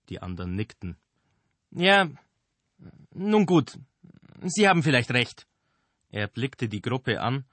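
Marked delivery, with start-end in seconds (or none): 1.7-5.5 grudging concession. Acted.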